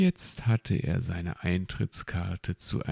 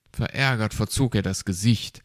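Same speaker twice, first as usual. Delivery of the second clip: ordinary delivery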